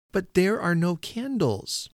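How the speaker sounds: put-on high, slow child's call; shouted. A narrator's reading. Child shouting over the wall to deaf old man, high call. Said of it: "I leant over the wall and shouted".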